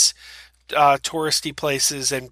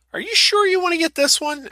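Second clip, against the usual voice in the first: high incredulous question. Retold or imitated. Sons questioning dad's shirt.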